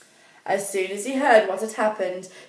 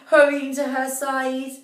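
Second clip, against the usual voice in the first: usual speaking voice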